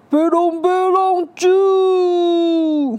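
high, loud alien cry; shouting. Acted. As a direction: yell at him to stop. Alien stops the villain.

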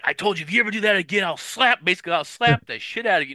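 fast, high-pitched mock tirade; hammed-up rapid threat; going off on someone. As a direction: imitate an indignant scolding at speed. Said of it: "he just goes off on Ensign Kim".

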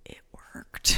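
breath-only whispered confession; whispered punchline. Retold or imitated. Husband admitting the hiccup cure to his wife, whispered. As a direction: whisper it like a guilty secret.

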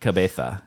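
"cabeza" with the z lisped as th, ca-BE-tha; lisped "cabeza", like a speaker from Spain; Spanish lisp. Shown as a demonstration. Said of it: "yeah, with the lisp".

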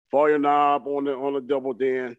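ordinary talk

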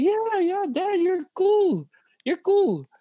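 very high, drawn-out cheer for dad; imagined admiring kids. Acted. Kids reassuring their dad as he had hoped, mock admiring. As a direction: imitate children's eager approval in a high voice. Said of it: "I was expecting to say".

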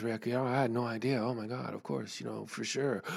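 mock-timid housemate folding under pressure; meek backing down. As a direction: shrink into a sheepish apology. Housemate meekly backs down, imitated.

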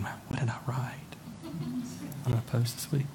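whispered worried aside; nervous whisper to yourself. Pretend. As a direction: hush it like a guilty thought. Congregant worrying about own post.

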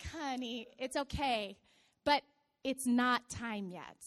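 low, soft, gentle reassurance; soothing nurse. Retold or imitated. Nurse calming a mother-to-be, soothing. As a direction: let her down gently, slowing to the end.

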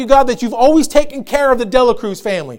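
loud, fervent thanksgiving prayer; preacher voicing a believer's bold prayer of thanks; confident prayer. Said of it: "all he does is speak what God has already told him".

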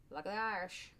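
light put-on Irish accent; attempted Irish accent. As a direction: toss in a hint of brogue. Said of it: "What kind of accent am I trying to do there?".

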